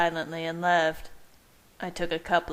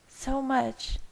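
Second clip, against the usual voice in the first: loving woman; 8 semitones higher than usual. A narrator's reading. Bella loving.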